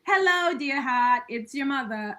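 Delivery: a fond mock Boston greeting; Boston accent. Son imitates his late mom's Boston voicemail greeting, in a Boston accent. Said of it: "she had a hardcore accent".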